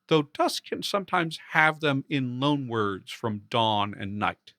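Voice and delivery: usual speaking voice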